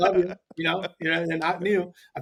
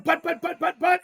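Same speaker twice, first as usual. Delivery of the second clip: sputtering protest; 11 semitones higher than usual. Retold or imitated. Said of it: "And I'm like".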